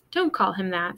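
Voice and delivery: usual speaking voice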